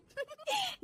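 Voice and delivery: short, high giggle; gloating laugh. Acted. Daughter-in-law plots to overwork mother-in-law, gleeful giggle. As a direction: giggle wickedly to herself. Gloats over her new power.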